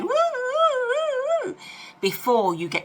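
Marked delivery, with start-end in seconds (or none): 0.0-1.6 high whooping woo; dizzy woo. Retold or imitated. Your head spun by a love bomber, high whoops. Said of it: "your head's going".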